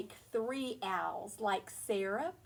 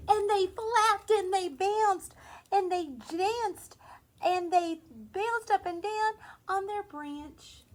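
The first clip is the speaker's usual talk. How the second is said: lively, higher storybook voice; reading to children; 8 semitones higher, 9 dB louder than usual. A narrator's reading. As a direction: read it big and bouncy for little ones. Reading a picture book aloud.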